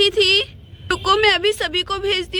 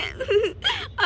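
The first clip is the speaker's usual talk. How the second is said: high soft sob; 7 semitones higher, 6 dB quieter than usual. Acted. Bride crying at farewell.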